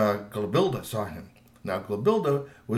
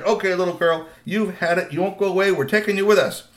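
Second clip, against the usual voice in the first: raised, higher, threatening voice; fed-up teen troll; 6 semitones higher, 5 dB louder than usual. A narrator's reading. Teen troll to girl on his back, fed up. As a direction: lay down the law to the little pest.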